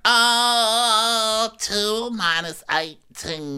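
high put-on voice, slowing over the sum; long high "Oh", then slow mock figuring; mock flustered housewife. Dodging Andy's money question, high slow mock voice. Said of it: "And she's like".

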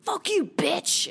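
high, drawn-out angry insult; retold harasser's outburst; imitated abusive shout. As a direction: hurl it after someone walking away.

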